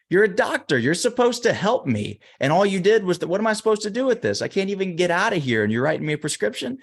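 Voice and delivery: acted outrage, pitch leaping up in disbelief; indignant protest. Stuck man to the useless doctor, indignant protest.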